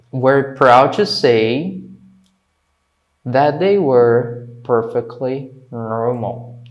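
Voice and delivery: slow, deliberate phrase-by-phrase reading; measured model reading with clear gaps; slow teacher read-aloud. Shown as a demonstration. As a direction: dictate it slowly to learners. Teacher reads text slowly for learners.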